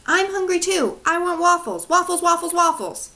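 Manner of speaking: put-on high, loud little girl begging for waffles; a small sister whining for waffles over and over. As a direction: chant it like an eager little kid. Little sister Violet voiced.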